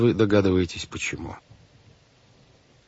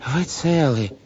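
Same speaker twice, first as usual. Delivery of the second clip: anxious relief; 8 semitones higher, slower than usual. A narrator's reading. Relieved guardian greets missing children.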